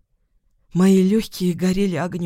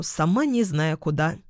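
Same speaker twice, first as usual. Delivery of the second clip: ordinary delivery, nothing marked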